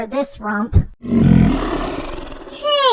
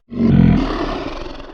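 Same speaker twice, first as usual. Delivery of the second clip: sharp thrilled intake of breath; delighted gasp. Acted. Sees the perfect undies.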